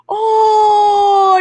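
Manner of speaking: high, long drawn-out "aww"; stretched sentimental coo; cooing audience. Retold or imitated. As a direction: imitate a crowd swooning. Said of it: "people in the audience like".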